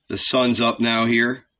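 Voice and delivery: plain reading voice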